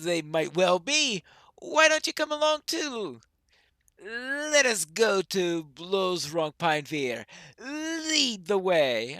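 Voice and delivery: lightly voiced dwarf, lilting and emphatic; a hint of a hearty dwarf; Gimli. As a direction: tease the elf, then set off boldly. Voices Gimli answering Legolas.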